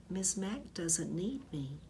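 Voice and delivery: plain reading voice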